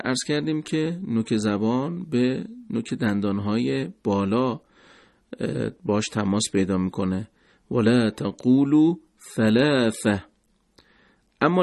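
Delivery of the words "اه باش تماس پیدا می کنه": ordinary talk, nothing marked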